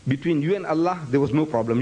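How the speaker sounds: ordinary delivery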